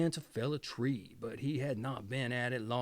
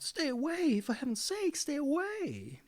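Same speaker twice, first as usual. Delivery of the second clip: scream; 13 semitones higher than usual. A narrator's reading. Sister stuck to the goose screams at the third sister. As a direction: shriek it out in alarm. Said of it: "the other screamed out".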